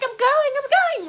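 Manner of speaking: high, loud acted reply; exaggerated shouted comeback; hurried protest. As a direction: yell back while rushing. Hurried reply to rushing sister.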